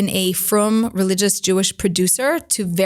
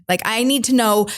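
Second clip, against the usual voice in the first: ordinary talk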